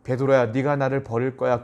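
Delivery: gentle, unaccusing warning; concerned and tender, held back; worried, loving. Shown as a demonstration. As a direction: break hard news to someone you love. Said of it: "이 얘기를 걱정스러운 마음으로".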